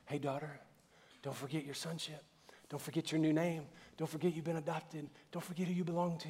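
a hint of a tender heavenly Father; tender fatherly reassurance. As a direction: reassure a child that they belong. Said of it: "He's always gonna go".

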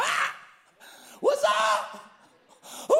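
fired-up TV host yell, big whoops; amped-up Ty Penny. Retold or imitated. Imitating the host greeting a family, fired-up shouting. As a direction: burst out loud and hyped. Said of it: "fired up, man".

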